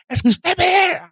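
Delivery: an angry man scolding a worker; irate old man. Retold or imitated. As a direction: rant like an offended old man. Angry man insulting him.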